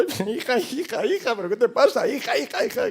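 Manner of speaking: high, urgent mock alarm; panicked father. Father answering his crying daughter's call, high-pitched panic. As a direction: plead frantically down the phone.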